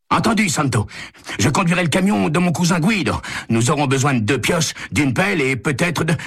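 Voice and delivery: high, businesslike caller voice; phone caller. A narrator's reading. Caller arranges truck and tools with Santo, high-pitched. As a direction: list the plans in a high character voice.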